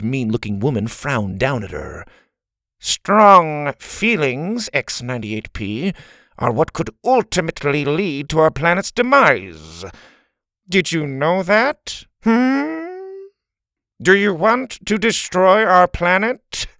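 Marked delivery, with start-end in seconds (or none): 2.8-5.9 slow, stressed nasal warning. Acted. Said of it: "nasally voice". 6.4-16.7 nasal voice. Acted. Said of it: "nasally voice".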